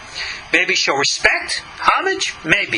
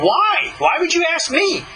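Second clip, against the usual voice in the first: high, surprised "why would you ask me"; acting his own amazement at the request; 10 semitones higher than usual. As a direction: retell your own startled question, pitched up. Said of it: "To which I was so surprised".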